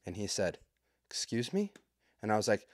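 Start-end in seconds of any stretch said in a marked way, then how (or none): 1.1-1.7 higher, softer questioning voice; politely baffled, pitched up and quiet; taken-aback dad. Acted. Dad questioning a rude stranger, taken aback.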